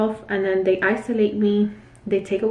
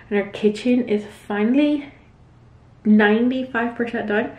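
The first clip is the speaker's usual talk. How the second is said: usual speaking voice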